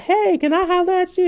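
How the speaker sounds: high, flirty come-on; sweet talk him in a squeaky voice. Retold or imitated. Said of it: "she be like".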